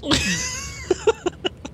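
a sudden high shriek of laughter; burst of laughter. Real, not acted. Player laughing hard at the torture idea. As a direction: crack up at the joke. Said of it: "I was so concerned".

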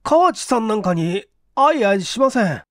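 nervous denial. Acted. Okamoto nervously denies.

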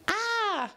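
high scream while falling. A narrator's reading. Pinky pushed over the edge, high scream. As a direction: scream as she falls off the edge. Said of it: "The pink pony screamed".

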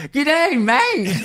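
high-pitched mock Aussie yell; yelled Australian accent. Retelling a friend's late-night phone prank, yelled Australian accent. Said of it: "yelling in an Australian accent".

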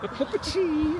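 high, playful mock voice; high silly voice. Repeating in silly voice.

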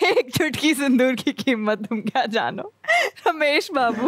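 laughing through the line. Shown as a demonstration. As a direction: deliver it while giggling. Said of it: "full हंसते हंसते".

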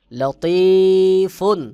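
slow melodic tilawat, long held notes; Quran recitation. Shown as a demonstration. Teacher recites the verse melodically for students. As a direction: chant it slowly, sustaining the vowels.

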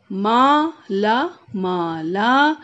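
माला with both आ drawn slowly; stretched aa. Shown as a demonstration. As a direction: hold each आ in माला. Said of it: "खींच के बोलना है".

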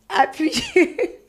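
laughing at her own panic; laughing while recalling frozen fingers. Real, not acted. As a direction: break into a laugh mid-word. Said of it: "tellement j'étais en panique".

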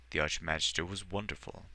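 ordinary delivery, nothing marked

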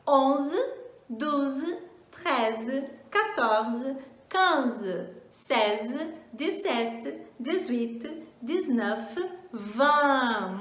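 onze to vingt run through again in clear French; French teens repeated. Shown as a demonstration. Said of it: "Vamos treinar mais uma vez?".

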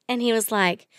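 normal talking voice

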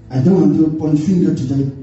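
normal talking voice

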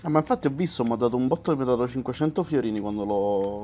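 normal talking voice

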